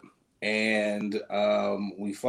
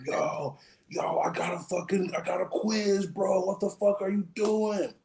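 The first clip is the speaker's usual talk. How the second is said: higher, frantic complaint; frantic friend; 8 semitones higher than usual. Retold or imitated. Buddy late for his quiz, frantic higher yelling. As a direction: freak out about the quiz. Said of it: "He was like".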